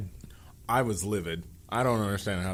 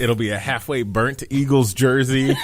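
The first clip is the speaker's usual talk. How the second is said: loud, laughing through the words; laughing shout; 6 dB louder than usual. Real, not acted. Joking to his co-host about the jersey, laughing shout. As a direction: blurt it out while cracking up. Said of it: "laugh shout heard".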